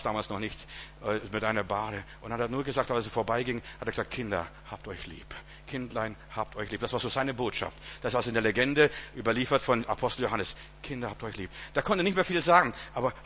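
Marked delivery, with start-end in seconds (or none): 4.1-6.8 put-on frail old apostle. Voicing the aged apostle John. 10.8-11.5 put-on frail old apostle. Voicing the aged apostle John.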